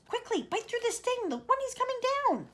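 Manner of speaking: put-on high, urgent boy's call; James yelling. James yelling to the centipede to bite the string. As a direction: urge the centipede on fast. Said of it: "yelled James".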